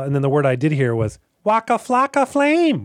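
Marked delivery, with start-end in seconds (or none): 1.4-2.9 put-on high, slow Wisconsin accent. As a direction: draw out [name] in a Wisconsin accent. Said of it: "in the sort of a, you know, like, a Wisconsin accent".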